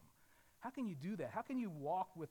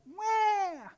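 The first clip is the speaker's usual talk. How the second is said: put-on baby wail; 13 dB louder than usual. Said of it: "baby is not comfortable".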